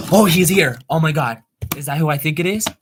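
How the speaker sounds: loud, high burst of excitement; real thrilled outburst, pitch jumping up; excited surprise. Real, not acted. Streamer spots a friend arriving in chat, excited surprise.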